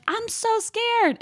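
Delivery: very high, slow mock-scared voice; drawn-out, high-pitched mimicked fear; Portia. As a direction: imitate a frightened cry way up high. Mimics frightened Portia in clip.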